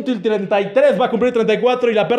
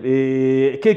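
usual speaking voice

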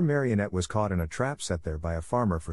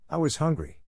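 put-on higher, pleading little boy; small puppet voice; 7 semitones higher than usual. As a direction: beg like a caught child. Pinocchio answers the glowworm.

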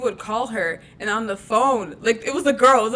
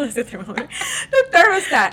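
high laughing self-correction; 5 semitones higher, 6 dB louder than usual. Real, not acted. Correcting the thermometer mix-up through real laughter. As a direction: laugh at your own slip.

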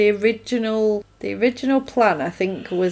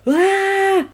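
long, high held cry; sustained high yell, not very loud; mock yell; 11 semitones higher, 3 dB louder than usual. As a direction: imitate a mirror-shattering scream. Joey's power of speech breaks mirrors.

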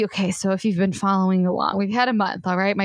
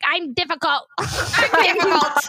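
lightly voiced tearful Sally; a hint of crying Sally, slowed and drawn out; mimicking a weepy movie heroine; Sally Albright impression; 7 semitones higher than usual. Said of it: "she's crying and she's like".

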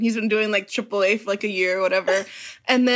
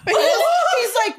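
ordinary talk, nothing marked

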